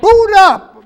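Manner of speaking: high, loud command at the machine; angry yell. Acted. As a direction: bark it at the frozen screen. Yelling at stuck computer.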